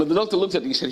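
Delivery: ordinary talk, nothing marked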